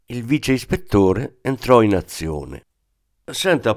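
ordinary delivery, nothing marked

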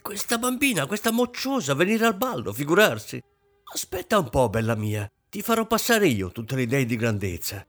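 high-pitched, indignant tirade; enraged scolding. A narrator's reading. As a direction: tear into the daughter with mounting outrage. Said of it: "Proseguì sullo stesso tono". (sgridata collerica, con voce acuta)

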